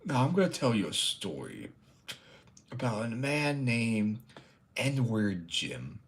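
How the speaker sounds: put-on slow old storyteller with long pauses; storytelling grandpa. Grandpa beginning a story for the children, slow hammy drawl. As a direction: spin a yarn like a pipe-smoking grandpa. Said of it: "This is what the pipe reminds me of".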